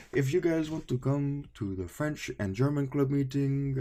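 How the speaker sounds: high, imitated Eastern European accent; Doofenshmirtz-like accent. Former student imitating professor inviting the class, Doofenshmirtz-like accent. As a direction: put on a weird, vaguely Russian accent. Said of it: "Sounds like Doofenshmirtz".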